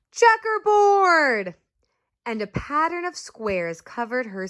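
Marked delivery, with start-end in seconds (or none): 0.1-1.6 high, slow drawn-out shout; kid shouting. Acted. Classmate shouting a pattern, high slow shout. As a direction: call it out like a kid at a game. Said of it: "Someone else shouted".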